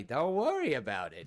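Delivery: loud, brush-off droid voice; John Mulaney. Acted. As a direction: wave them off as a cartoon clown comedian. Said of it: "I made John Mulaney sound".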